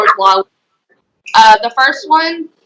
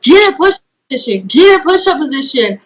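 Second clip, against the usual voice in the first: higher, quick mock yell; mock drill order, raised pitch without full volume; retold screaming; 5 semitones higher than usual. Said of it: "he's still screaming at me".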